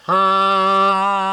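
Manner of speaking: sung ah through the nose; nasal ah. Shown as a demonstration. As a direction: sing ah with air in the nose. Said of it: "the air is flowing through my nose".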